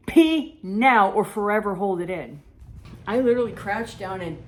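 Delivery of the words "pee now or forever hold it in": inner scream. Retold or imitated. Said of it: "I'm screaming".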